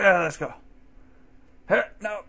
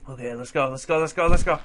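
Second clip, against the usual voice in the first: usual speaking voice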